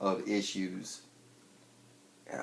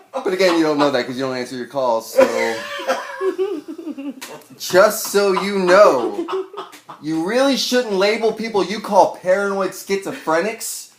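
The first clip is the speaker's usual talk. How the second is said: loud, high, sneering sign-off; angry voicemail; 11 semitones higher, 15 dB louder than usual. Retold or imitated. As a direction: yell the threat then spit the goodbye. Voicemail rant continues.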